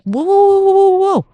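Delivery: high, loud startled whoas; backing away in alarm; mock-startled high yelp. People startled by her arrival.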